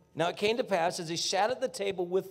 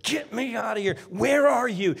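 louder and higher, pleading; desperate cry; 5 semitones higher, 6 dB louder than usual. Retold or imitated. A suffering believer crying out to God, desperate.

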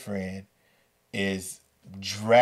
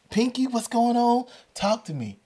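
acted urgent plea, pitched well up; urgent questioning; 3 dB louder than usual. Teacher to scared student on phone, urgent high voice. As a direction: press the caller for answers, high and insistent.

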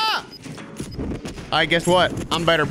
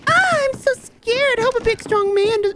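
very high, slow falsetto damsel; mock damsel. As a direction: play the helpless lady.

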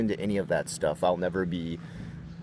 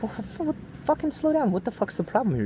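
furious outburst. Retold or imitated. Stopping a rushing man on the escalator, yelling high. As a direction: shout him down, voice cracking high. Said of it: "I stopped this guy and I'm like".

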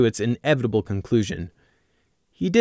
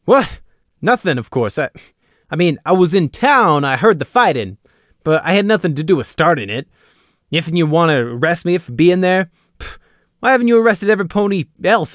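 higher, louder defensive protest; 6 semitones higher, 4 dB louder than usual. A narrator's reading. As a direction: protest too much, rattled. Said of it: "He defended himself".

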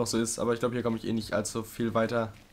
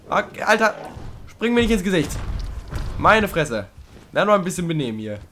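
higher and louder, telling the beast off; startled scolding; 6 semitones higher, 5 dB louder than usual. Real, not acted. Player scolds Trico for jumping in his face, loud and startled. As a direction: snap at it to behave.

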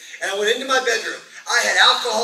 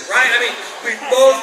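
louder, rising panic, half laughing; panicked outburst; 3 semitones higher, 5 dB louder than usual. Retold or imitated. Recalling the panic of thinking God blinded them, louder and half laughing.

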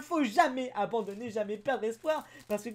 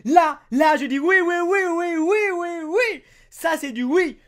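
loud, high chanted oui oui; joyful yelling; 6 semitones higher, 12 dB louder than usual. Real, not acted. Celebrating the full art for viewers, loud repeated cheering. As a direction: shout yes over and over.

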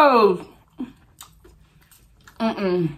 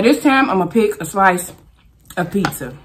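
usual speaking voice

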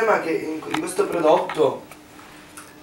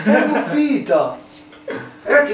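laughing; 10 semitones higher than usual. Real, not acted. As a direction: laugh through the words. Laughing at moldy food.

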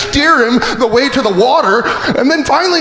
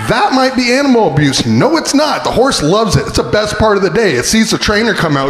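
ordinary talk

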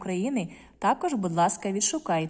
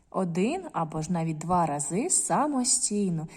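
ordinary talk, nothing marked

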